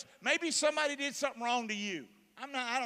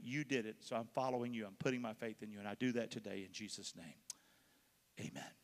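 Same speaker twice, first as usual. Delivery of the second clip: leading a prayer; 7 semitones lower than usual. Acted. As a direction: pray humbly, sinking down to the amen. Leads a sinner's prayer.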